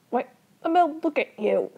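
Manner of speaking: high, loud, indignant cry; exasperated outburst. Acted. Older brother challenging his little brother, exasperated outburst. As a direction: burst out in exasperation.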